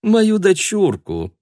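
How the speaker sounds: very high, slow cooing; doting mother. Acted. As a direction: coo over the little daughter.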